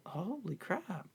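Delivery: slow, hushed, high-pitched disbelief; softly stunned imitation; mock astonishment. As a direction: breathe it out slowly in awe. Acting a viewer's stunned reaction.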